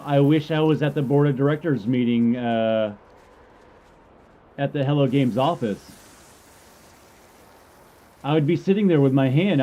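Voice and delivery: ordinary talk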